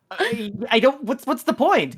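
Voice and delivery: a mock-puzzled hero asking why; baffled player. Player voiced in kobold story.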